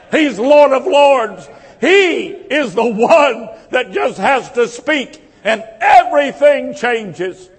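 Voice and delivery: rising, ringing preacher's proclamation; preaching at full force. Acted. Pastor proclaims to the congregation that Jesus has power, ringing preaching. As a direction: build it like a revival sermon.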